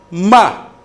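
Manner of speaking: "Ma" short, starting high and falling sharply; fourth tone. Shown as a demonstration. Teacher demonstrates the last tone, falling "Ma". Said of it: "le dernier ton".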